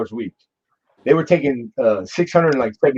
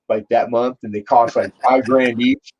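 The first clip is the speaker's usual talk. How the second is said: usual speaking voice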